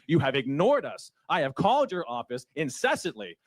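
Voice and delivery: ordinary delivery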